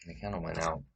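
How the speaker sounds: normal talking voice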